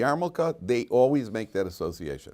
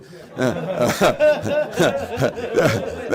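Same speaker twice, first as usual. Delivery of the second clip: loud, high burst of laughter; real laugh; big laugh; 7 semitones higher, 5 dB louder than usual. Real, not acted. Laughs at own joke.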